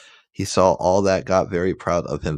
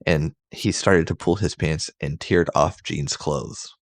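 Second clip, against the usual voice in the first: ordinary delivery, nothing marked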